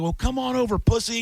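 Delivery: high-pitched goading comeback; retold taunt. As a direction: dare him, replaying the phone quarrel. Taunting back in retold quarrel.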